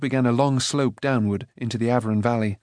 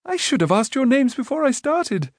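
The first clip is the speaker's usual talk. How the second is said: put-on much higher, breezy young voice; scatterbrained prince; 13 semitones higher than usual. A narrator's reading. Rune to the companions, forgetful. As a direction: blurt it as the thought comes.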